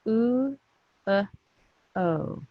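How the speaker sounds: "Oo" high, then "uh" short, then "o" falling; phonics sounds. Shown as a demonstration. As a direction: sound out "Oo", "uh", "o" one by one with pauses. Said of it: "Three sounds".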